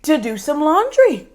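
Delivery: high, slow, squeaky excuse; nervous excuse. Acted. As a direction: stall with a high sheepish voice. Caught, making a weak excuse.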